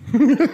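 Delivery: short, high-pitched laugh. Real, not acted. Prankster cracking up at an angry caller, laughing. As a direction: let out a quick high giggle.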